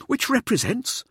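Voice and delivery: high, loud prompting question; pressing. A narrator's reading. Showboater presses a colleague for the meaning, high and insistent. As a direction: push for the answer, pitched right up. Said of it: "Mirza pressed".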